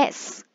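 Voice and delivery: S đọc như tiếng xì /s/ nhẹ; ending s sound. Shown as a demonstration. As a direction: hiss a soft s. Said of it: "Thứ nhất mình sẽ đọc là". (âm s vô thanh)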